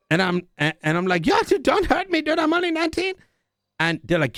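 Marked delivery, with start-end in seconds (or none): 1.2-3.1 mock panicked plea. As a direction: squeal it like a scared kid. Begging gang not to hurt.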